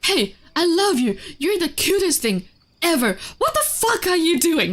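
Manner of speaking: loud, high mock telling-off; scolding. Shown as a demonstration. Demonstrating how tone scares a dog, loud scolding. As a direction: scold the dog with loving words. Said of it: "I can just scold them".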